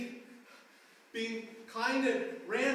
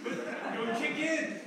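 mom's voice; 5 semitones higher, 5 dB louder than usual. Retold or imitated. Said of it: "my mom's voice".

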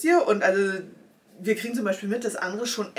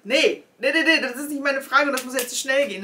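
louder, higher frantic protest; 6 semitones higher, 6 dB louder than usual. Retold or imitated. Rejecting the FAQ, loud frantic protest. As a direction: rush it out in panic. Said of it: "Und ich so".

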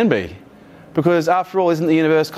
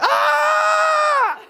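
shrill, much higher panicked cry; panicked sell; much slower than usual. Retold or imitated. Panicking mums and dads dumping stock, very high panicked squeal. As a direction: squeal it like a panicking small investor. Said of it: "mums and dads are like".

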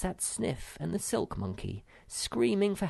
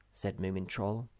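plain reading voice

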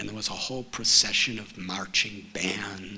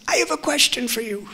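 put-on much higher, fast, small boy's voice; little child's voice; 12 semitones higher, 6 dB louder, faster than usual. Five-year-old Parasara challenging the debate champion, high child's voice. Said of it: "in a little child's voice".

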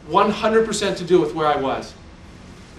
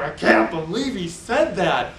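imitating shocked crowd; slower than usual. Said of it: "you could tell they're all going".

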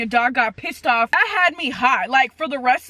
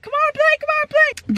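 much higher squeaky begging voice; pleading dog voice. Retold or imitated. Voicing her dog begging the new dog to play, high squeak. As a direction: squeak it like a pup who wants to play. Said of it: "trying to be like".

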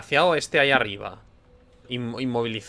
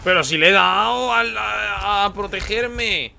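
higher, louder, pleading with the game; indignant protest; 6 semitones higher, 3 dB louder than usual. Real, not acted. Gamer protesting to the game, indignant. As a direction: protest that you did hit it.

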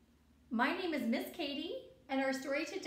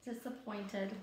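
sad; 5 semitones lower, 6 dB quieter than usual. Acted. Teacher repeats the word to children, low and sad. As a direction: lower and softer, drooping.

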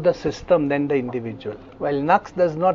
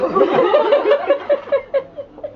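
real burst of laughter; 4 dB louder than usual. Real, not acted. Teacher laughs at own joke.

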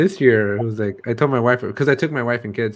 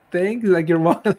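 awkward thanks; 6 semitones higher, 5 dB louder than usual. Retold or imitated. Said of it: "I had no idea what to say".

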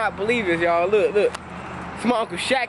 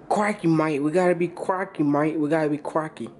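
ordinary delivery

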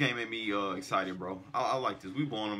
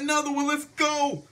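high, loud hype chant; catchphrase shout; 7 dB louder than usual. Real, not acted. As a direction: belt out your catchphrase at the TV. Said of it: "This is what I like to say".

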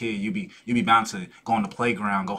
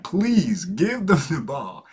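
slow, spaced-out, higher-pitched pleading; emphatic plea; 5 semitones higher, much slower than usual. Retold or imitated. Player begging teammate to avoid karma, emphatic plea. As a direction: draw out each word as a plea. Retold plea during a game.

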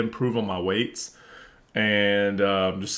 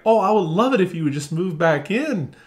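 mock sugary sweet; 6 semitones higher than usual. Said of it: "that's so different from me saying".

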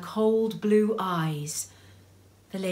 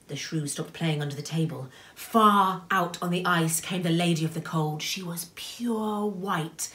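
plain reading voice

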